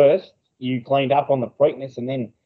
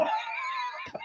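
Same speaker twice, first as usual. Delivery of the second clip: high squealing laugh; 14 dB quieter than usual. Real, not acted. Laughs at his own story to his mate, high squealing laugh.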